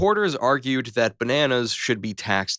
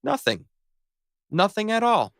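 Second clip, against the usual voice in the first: sly high voice; 10 semitones higher than usual. Acted. Sly joke answer.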